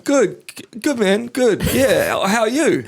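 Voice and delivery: high-pitched, halting put-on politeness; mock-awkward "good, man"; awkward polite reply. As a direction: act flustered small talk up high. Acting the player's awkward reply.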